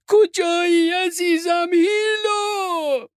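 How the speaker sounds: long, high, wailing call; mother's shout. A narrator's reading. Mother cries out for beloved Hilo, loud drawn-out shout. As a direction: shout out slowly for a missing child. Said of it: "مادرش داد زد".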